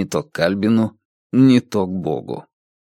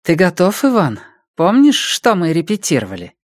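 higher-pitched, lightly urgent questioning; voiced Ди; 8 semitones higher than usual. A narrator's reading. Ди checks Иван remembers the rehearsal, lifted questioning voice. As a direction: prompt him with a lifted, pressing voice.

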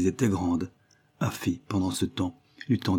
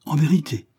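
higher, louder, relieved; relieved exclamation; 8 semitones higher, 4 dB louder than usual. A narrator's reading. Joyce relieved Afy is not with Richard, exclaiming. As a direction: cry out with a weight lifted. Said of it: "s'écria Joyce".

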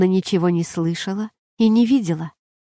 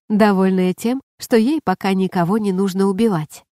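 ordinary delivery

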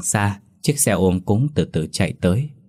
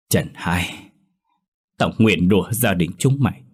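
giận dữ nguyền rủa. A narrator's reading. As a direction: spit the curse with restrained rage. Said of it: "Bà cằn trong sự tức giận". (bà cụ nguyền rủa trong cơn giận, giọng kìm nén)

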